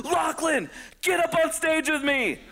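high call across the room. Retold or imitated. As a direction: summon him up on stage. Comic calls him on stage.

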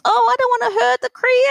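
high, fast, fretful imitation of a panicking man; mock panic. Wife imitates Anthony panicking with his hand in the alpaca, high and fast mock fretting. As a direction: squeak it out fast like someone scared of hurting the baby. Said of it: "Anthony was panicking".

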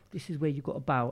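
a soft, hushed aside to a sibling, half-voiced; retold whisper. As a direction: lean in and hush it like a child prompting her brother. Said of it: "she even whispered to her brother".

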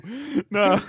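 high drawn-out boo; mock booing. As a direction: boo the joke playfully. Mock heckling at a joke.

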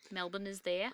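put-on amateur Australian accent, r's dropped; terrible Australian accent. Host pointing at a map for co-host, in a bad Australian accent. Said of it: "I have a terrible Australian accent".

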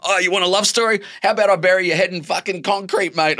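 passer-by mocking the writer, hammed-up aggressive; mock hostile passer-by. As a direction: ham up a rude local threatening him.

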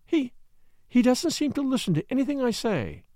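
high, hurt and baffled, lightly angry; a hint of angry confusion; bewildered anger. A narrator's reading. Wife complaining that her husband ignores her, bewildered anger. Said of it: "bewilderment as well as anger in her voice".

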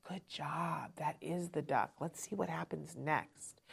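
soft, hushed praise that keeps fading. Shown as a demonstration. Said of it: "you get quieter and quieter".

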